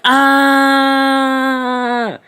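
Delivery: high loud squealed scream; mock scream. Still scared of the flag gun, high squealed scream. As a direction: shriek in fake fright. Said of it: "we're still like".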